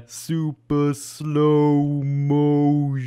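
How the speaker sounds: stretched-out, very slow voice; slow motion voice. Pretend. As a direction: drag every word like a slowed tape. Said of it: "really terrible slow motion voice impression".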